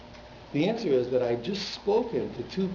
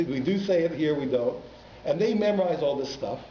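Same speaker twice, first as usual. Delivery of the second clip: normal talking voice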